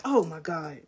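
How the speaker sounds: ordinary talk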